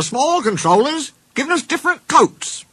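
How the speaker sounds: very high little-engine voice; small engine Rex. Acted. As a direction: chat on in a high, small character voice.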